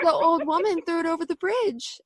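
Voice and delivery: high, put-on voice for the clip line; quoting a viral clip. Interviewer quoting her favorite [name] clip, high mock voice. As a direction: mimic the sound clip up high. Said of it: "the [name], the part".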